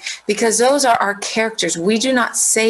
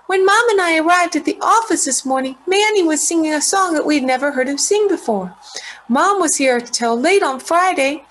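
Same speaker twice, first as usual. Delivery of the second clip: high, brisk character voice laying out clues; high-voiced Olivia reasoning it through; Olivia, confident young sleuth; 7 semitones higher than usual. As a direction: explain the deduction in a raised-pitch stage voice. Reads Olivia's explanation in the play.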